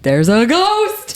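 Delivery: slow, drawn-out ghost reveal; spooky announcement. Retold or imitated. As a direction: announce it slowly like a spooky secret. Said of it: "I'm like".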